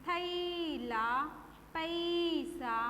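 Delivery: थैला, पैसा with the first vowel held very long; drawn-out ai vowel. Shown as a demonstration. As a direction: stretch the ai in थैला and पैसा slowly. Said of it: "उस पे जोर देकर बोलना".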